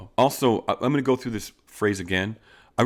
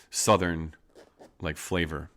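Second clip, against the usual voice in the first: ordinary delivery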